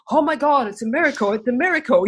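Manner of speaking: high-pitched imitation of an overjoyed doctor; amazed rejoicing. Doctor dancing round his recovered patient, amazed rejoicing. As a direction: act out jubilant astonishment. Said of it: "dancing around her going".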